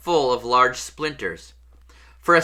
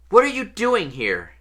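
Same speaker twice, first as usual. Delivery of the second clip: startled questioning; 7 semitones higher than usual. A narrator's reading. Harry questions the intruding elf.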